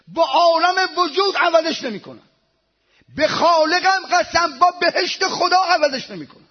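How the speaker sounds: loud, high-pitched exclamations of awe; fervent pulpit cry; impassioned preaching. Real, not acted. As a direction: preach at full force, drawing out the praise. Preacher swears his devotion.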